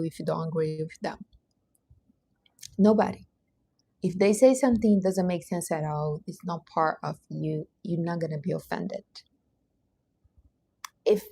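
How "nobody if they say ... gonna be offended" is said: ordinary talk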